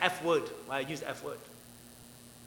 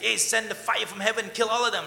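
raised, louder call for destruction; fiery demand; 6 semitones higher, 6 dB louder than usual. Acted. John and James calling on Jesus to strike, fiery demand. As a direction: urge it on with zeal, higher and louder. Acting out vengeful disciples' demand.

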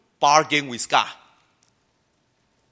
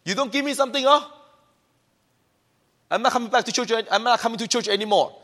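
higher, petulant whining threat; mock sulky threat; 5 semitones higher, much faster than usual. Preacher mimics a churchgoer threatening to leave, mock petulance. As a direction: pout like a child who wants something. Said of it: "So when you say".

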